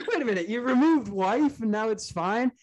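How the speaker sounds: high, amused incredulous question; laughing disbelief. Acted. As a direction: laugh through the realization.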